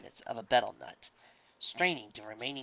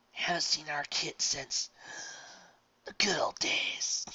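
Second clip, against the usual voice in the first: put-on slow, fading old man's whisper; slow weak whisper; 3 semitones higher, slower than usual. Old Louis reminiscing to Kit, slow whisper. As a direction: let the memory trail off in a whisper.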